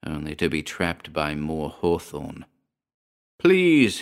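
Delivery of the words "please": high, loud, desperate plea; frantic begging; beseeching. A narrator's reading. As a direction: cry out for mercy, pitch leaping then falling. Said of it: "he beseeched again".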